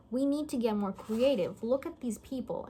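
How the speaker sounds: usual speaking voice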